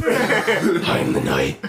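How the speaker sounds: slow, growly hero line; lightly voiced Batman. Quoting Batman.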